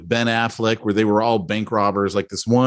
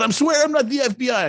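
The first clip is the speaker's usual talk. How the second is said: pleading fed; 12 semitones higher than usual. Retold or imitated. Retelling agent's denial.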